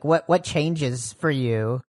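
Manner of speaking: ordinary delivery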